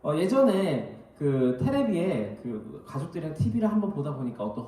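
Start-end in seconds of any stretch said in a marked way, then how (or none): none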